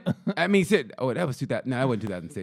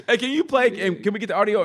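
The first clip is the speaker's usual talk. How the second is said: ordinary delivery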